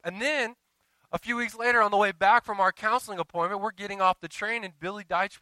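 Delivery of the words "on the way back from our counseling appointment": ordinary talk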